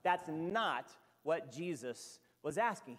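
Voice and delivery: usual speaking voice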